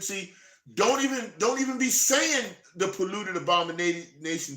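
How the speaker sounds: high, loud preacher's cry, pressing the point; fiery preaching. Acted. Preacher warning the listeners about talking to heathens, fiery and loud. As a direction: preach it hard at the congregation.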